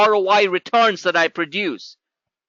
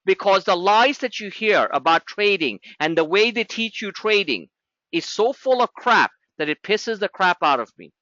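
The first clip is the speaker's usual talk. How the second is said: ordinary delivery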